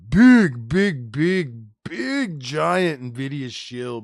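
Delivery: punching each big, then stretching out big giant Nvidia shill slowly; emphatic repetition. Real, not acted. Streamer calling a reviewer a shill, drawn-out emphatic big big big. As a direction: hammer each big harder. Ranting about Daniel Owens.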